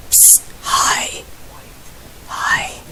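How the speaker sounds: soft unvoiced whisper. Real, not acted. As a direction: whisper so nothing hears you. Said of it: "Why are you whispering in here?".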